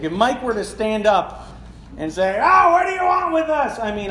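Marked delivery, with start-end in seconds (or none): 2.4-3.8 high, loud shout over the room. Pretend. Congregant Mike standing up mid-sermon, shouting at the preacher. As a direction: yell it out like a heckler breaking in. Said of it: "someone shouting out in the middle of the service".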